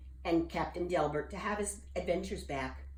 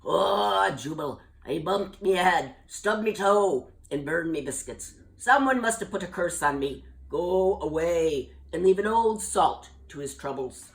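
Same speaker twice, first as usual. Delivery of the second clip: put-on louder, gruff old salt complaining; grumpy old sailor; 7 dB louder than usual. Captain Delbert grumbling at Jubal, old salt voice. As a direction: grumble like a sea dog.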